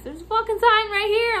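high, loud irritated complaint; exasperated retort. Retold or imitated. Clerk asked a price already posted, high exasperation. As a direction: fire it back, fed up. Annoyed reaction to price question.

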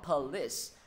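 POLICE as puh-LIS; POLICE with its s sounded; English final s. Shown as a demonstration. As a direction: finish POLICE with a clear hissed s. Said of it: "chứ thầy không đọc là police".

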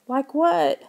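trembling demand. A narrator's reading. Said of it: "Lauren demanded in a trembling voice".

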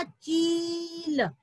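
चील with a stretched ee, then ल; long vowel. Shown as a demonstration. Teacher completing the word for children, drawn-out चील. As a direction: hold the ee before the ल in चील. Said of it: "तो शब्द बन गया था".

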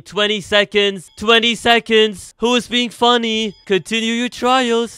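high, loud, stretched-out urging; urgent calling out. Real, not acted. Player urging others as seconds run out, loud high plea. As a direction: urge them on loudly, drawing the words out. Urges players as time runs out.

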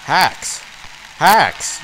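yelled high and loud at the screen; angry shout. Real, not acted. Gamer accusing the computer opponents of cheating, shouting.

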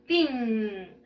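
put-on "Tin" stretched out long as teen; a very long "Tin" with an ee sound; long-drawn ending. Shown as a demonstration. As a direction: hold the vowel and final n of "Tin". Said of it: "Bien largo".

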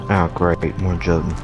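ordinary talk, nothing marked

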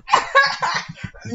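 high-pitched mocking cackle; exaggerated, forced high laugh in imitation; forced fake laugh. Mimicking the laugh Lanika just sent, forced and fake. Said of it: "forced, fake laugh".

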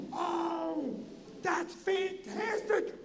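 high, slow, wacky delight. Retold or imitated. Entertainer hears the guest is a comic, drawn-out delight. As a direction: stretch out the praise in a high, odd voice. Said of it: "how the [name] are talking".

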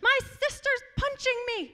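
put-on high, whiny child tattling on the phone; tattling little brother. Boy reports his sister to the police, high tattling voice. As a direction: tell on her like a wronged six-year-old. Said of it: "He said".

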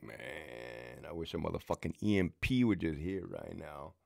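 low, hushed wish under the breath; grumbling quietly at the cameras; quiet mutter. Retold or imitated. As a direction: mutter it to yourself.

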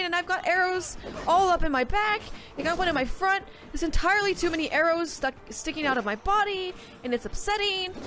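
high, lively play-by-play; high excited gaming commentary. Real, not acted. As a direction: rattle it off excitedly.

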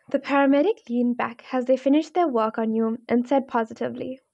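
ordinary delivery, nothing marked

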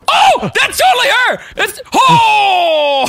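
startled high shouting. Real, not acted. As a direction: yell out in alarm. Attacked from behind.

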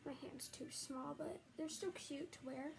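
ordinary talk, nothing marked

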